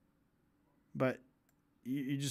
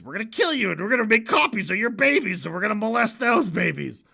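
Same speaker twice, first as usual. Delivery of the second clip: mock menace; 6 semitones higher, 7 dB louder than usual. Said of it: "how Alex does it".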